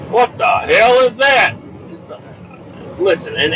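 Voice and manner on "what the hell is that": high, slow, drawn-out yell; escalated, high-pitched bafflement; outraged shout. Acted. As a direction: yell the question in comic disgust. Repeats the question, now shouted.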